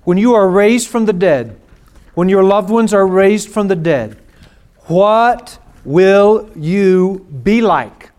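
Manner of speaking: loud, high, slow pulpit proclamation; preaching at full force. Acted. Pastor proclaiming resurrection to grieving listeners, full-force preaching. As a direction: thunder the promise across the room.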